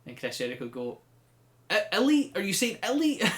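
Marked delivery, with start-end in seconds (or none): 1.7-3.2 high, loud baffled question; incredulous query. Retold or imitated. Jericho baffled by Matt Hardy, loudly incredulous. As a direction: squawk it in mock disbelief.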